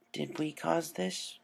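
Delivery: quiet, slow, fearful; hushed worried question. A narrator's reading. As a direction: ask it small and guilty. Rainbow shocked, guilty.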